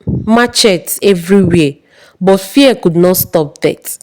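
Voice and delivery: plain reading voice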